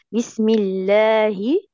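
بسم الله said very slowly; drawn-out recitation model. Shown as a demonstration. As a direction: model the phrase slowly for a student. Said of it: "هاتي لي بس الراء المشددة".

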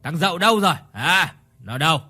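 put-on angry drunk demand. A narrator's reading. Drunk father demands, whip cracking.

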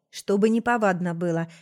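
plain reading voice

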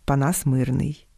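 ordinary talk

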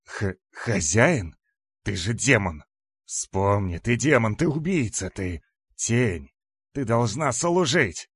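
громкий возмущённый крик демона; верещи, требуя повиновения; верещание демона. A narrator's reading. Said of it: "верещавшего Раздора". (истеричные громкие упрёки)

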